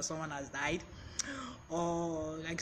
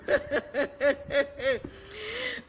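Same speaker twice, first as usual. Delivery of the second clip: a long, rolling laugh, higher than usual; 7 semitones higher, 4 dB louder than usual. Real, not acted. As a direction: keep laughing through it. Laughs at Scottish viewers.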